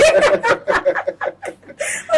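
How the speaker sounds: high burst of laughter; loud laugh. Real, not acted. Interviewer laughs at the demo.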